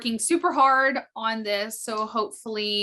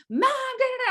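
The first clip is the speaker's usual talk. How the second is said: put-on kids whining. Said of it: "the kids are like".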